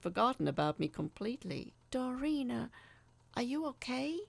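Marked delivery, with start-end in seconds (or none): 1.9-4.2 higher, careful worried voice; concerned question. A narrator's reading. Narrator checking on silent Doreen in car, worried. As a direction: ask gently.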